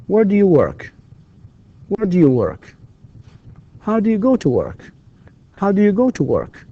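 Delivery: high start, each question falling on "live", "do", "work", "home"; falling intonation. Shown as a demonstration. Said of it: "and falling intonation here".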